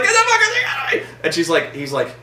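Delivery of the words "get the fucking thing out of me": very high, fast mock shriek; hammed-up screaming. Scientist demanding the alien be removed, hammed-up screaming. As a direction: shriek it quickly in exaggerated panic. Retold woman with alien inside.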